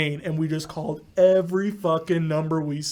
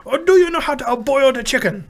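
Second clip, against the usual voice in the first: high, hammed-up Chinese accent; irate Chinese accent impression; 8 semitones higher, 4 dB louder than usual. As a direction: imitate an irate man in caricatured Chinese-accented English. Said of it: "do that irate Chinese man impression I do".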